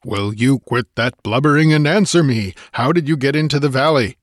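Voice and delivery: impatient demand. A narrator's reading. Conan shaking the sobbing girl, impatient. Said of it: "With an impatient imprecation".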